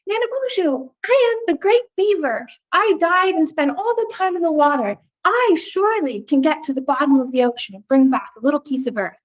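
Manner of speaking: high, proud little beaver voice; high-pitched storybook beaver. Acted. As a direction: puff up like a proud small beaver. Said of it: "always do these little voices".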